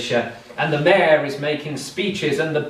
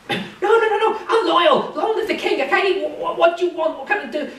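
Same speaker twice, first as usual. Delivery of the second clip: much higher, laughing in fear; nervous panicked mayor; 14 semitones higher than usual. Acted. Scared mayor swears his loyalty to the captain. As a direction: plead with a frightened laugh.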